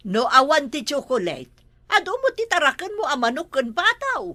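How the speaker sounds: ordinary delivery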